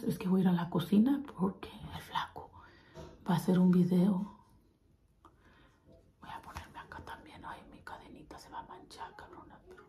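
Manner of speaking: hushed whisper. Real, not acted. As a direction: secret plan whispered. Whispering prank plans.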